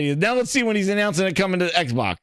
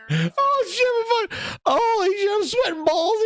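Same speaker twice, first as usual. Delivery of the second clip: high, wheezing laughter through the words; can barely talk for laughing; cracking up, half shouting; laughing fit; 12 semitones higher than usual. Real, not acted. Losing it laughing at the clip.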